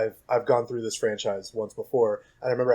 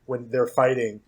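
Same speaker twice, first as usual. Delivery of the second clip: ordinary talk, nothing marked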